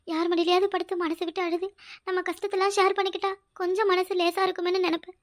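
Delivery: ordinary delivery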